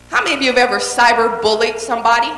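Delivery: ordinary talk, nothing marked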